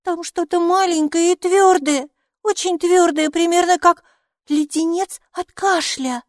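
put-on high, loud little-girl voice; excited child. A narrator's reading. Майки feels inside the pocket, high excited child voice. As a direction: describe it in a high, eager child's voice. Child figure describes the find.

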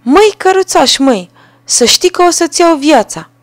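sparrow shouting. A narrator's reading. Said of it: "a strigat".

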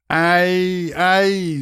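high, sustained yelps; crying out. A narrator's reading. Young lad dragged into the corridor, crying out. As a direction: yelp as if dragged by the hair. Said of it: "закричал парень".

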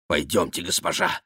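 low, soft urging; тихая просьба. Acted. Реми уводит госпожу. (низким тихим голосом)

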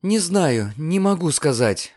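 ordinary delivery

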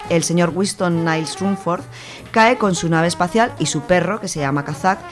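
ordinary talk, nothing marked

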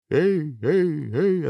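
high echoing repeats of a call. A narrator's reading. Echo answering the hero's call, high echoing cries. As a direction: call back like a mountain echo. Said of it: "откликнулось эхо".

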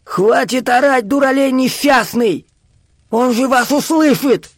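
put-on high, slow, strained old man's yell; agitated high-pitched scolding; nervous old man's cry. A narrator's reading. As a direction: cry out in alarm as a cross old man. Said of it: "раздался нервный крик старика".

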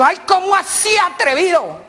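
high, loud indignant lady; offended lady. Acted. Lady scolds the flirting driver, high indignant squeal. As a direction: squeal it out, scandalized.

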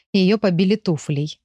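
ordinary delivery

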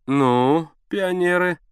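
sleepy teasing father; yawning. A narrator's reading. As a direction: say it through a lazy yawn. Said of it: "зевая, произнёс Константин Алексеевич".

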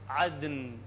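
عدن low, with a struck د and ringing ن; low, resonant عدن; drum-tap Dal. Shown as a demonstration. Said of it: "كأنما جاي تطرق طبلة".

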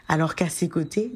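usual speaking voice

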